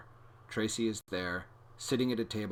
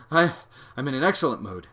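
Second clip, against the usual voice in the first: out of breath; 6 semitones higher, 10 dB louder, faster than usual. A narrator's reading. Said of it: "probably wondering why I'm so out of breath".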